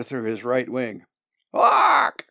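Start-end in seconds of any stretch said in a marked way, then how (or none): 1.5-2.3 very loud, high bird squawk; squawk of pain. Acted. Bird hit through the wing, pained squawk. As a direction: screech out like a wounded bird. Wounded bird's cry.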